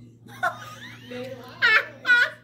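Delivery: shrieking, almost tearful laughter; high laugh. Real, not acted. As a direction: laugh until it nearly cries. Laughing at her own story.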